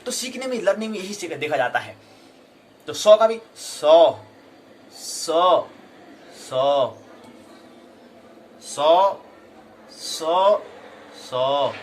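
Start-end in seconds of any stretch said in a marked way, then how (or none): 2.9-11.7 सौ said again and again with स, श and ष, the tongue moving each time; three sibilants. Shown as a demonstration. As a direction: demonstrate each सौ distinctly and loudly. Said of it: "सौ में कौन सी जीव कहाँ जा रही है". (सौ का तीन तरह से उच्चारण)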